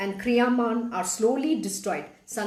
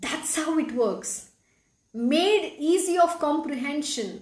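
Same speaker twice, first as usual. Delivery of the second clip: ordinary delivery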